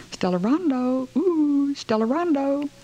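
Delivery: high drawn-out call up the stairwell; calling up the stairs. A narrator's reading. Mother calling her daughter upstairs, high sing-song call. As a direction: sing her name up to the upper floor. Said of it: "calls Stella Rondo".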